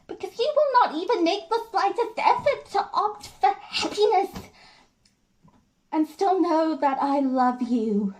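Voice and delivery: hurt wife building from control to an outburst; Jenny. Acted. Jenny watches George destroy everything she wanted, anguish building to fury. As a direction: start slow and measured, erupt high and loud.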